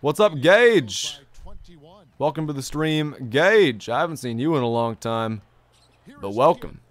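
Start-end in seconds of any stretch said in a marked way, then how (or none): none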